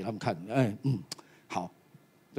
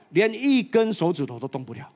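ordinary talk, nothing marked

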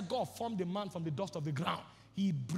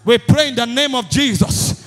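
loud, high, driving sermon voice; preaching at full force; 5 semitones higher, 19 dB louder than usual. Acted. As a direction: hammer each line at the crowd.

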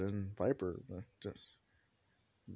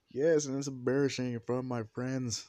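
louder, higher son's whine; embarrassed complaint; 4 semitones higher, 6 dB louder than usual. Acted. Embarrassed son complains in story.

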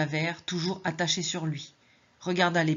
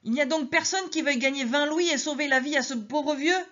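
high, pleading Madeleine; desperate plea; 8 semitones higher than usual. Acted. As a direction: beg the silent crowd.

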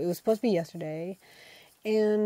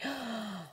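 mock shocked gasp; 3 semitones higher, 11 dB quieter than usual. Mock offence at insult.

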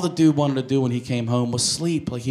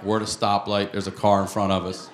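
normal talking voice

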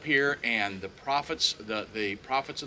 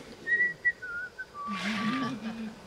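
high, thin, drawn-out whistle; casual high whistle; idle whistling; 4 dB quieter than usual. Acted. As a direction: whistle idly while waiting. Elijah idly waiting, imitated by whistle.

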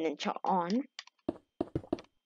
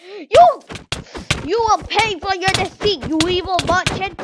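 mock villain; 8 semitones higher, 8 dB louder than usual. Mock battle cry at merchant.

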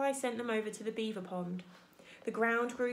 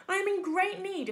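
higher-pitched polite call; friendly booming; 9 semitones higher, 5 dB louder than usual. A narrator's reading. Robot asks the old goose for help, calling out friendly. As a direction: appeal cheerfully across the pond. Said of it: "the robot's friendly voice boomed".

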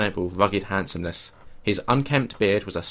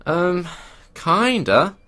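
high, hesitant um; 8 semitones higher, 4 dB louder, much slower than usual. Acted. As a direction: hedge awkwardly, pitched up. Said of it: "replied with a hesitation".

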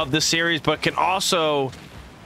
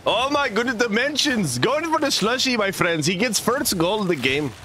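hammed-up Indian accent, higher throughout; 8 semitones higher than usual. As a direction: cast the play in a jokey Indian accent. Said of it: "I don't know if I can do the Indian one".

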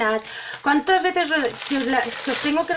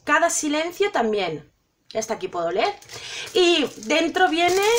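ordinary talk, nothing marked

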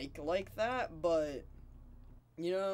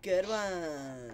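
that voice; 3 semitones higher than usual. Shown as a demonstration. Teasing a friend with the catchphrase, in that drawn-out voice. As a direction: stretch it out and let it fall. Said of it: "say it in that voice".

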